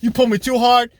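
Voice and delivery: loud pretend refusal; mock indignant. Woman refusing the man, loud mock indignation. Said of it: "she was like".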